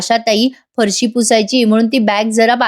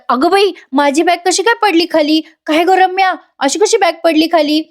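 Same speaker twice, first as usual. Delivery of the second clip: higher-pitched, mildly panicked questioning; flustered alarm; 6 semitones higher than usual. Acted. Aunt finds her bag fallen, flustered alarm. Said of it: "एवढं घाबरायला काय झालं तुम्हाला".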